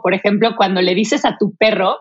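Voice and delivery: ordinary delivery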